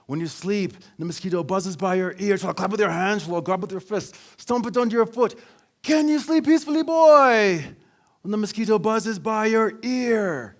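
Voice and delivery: put-on father driving home his lesson to his teenage son; dad voice, building up. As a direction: preach the mosquito lesson, rising to a crescendo. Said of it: "my dad continued".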